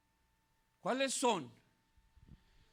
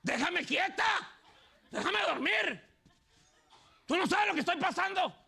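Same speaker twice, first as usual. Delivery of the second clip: much higher, louder, hammed-up shrewish retort; snappy wife; 8 semitones higher, 4 dB louder than usual. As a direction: snap back at your husband like a sulky wife. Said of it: "amoroso le dice". (esposa gruñona que contesta a gritos)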